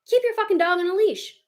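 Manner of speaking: real irritated rant; exasperated demand. Real, not acted. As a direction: let the frustration burst out at careless owners. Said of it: "I feel very strongly about this".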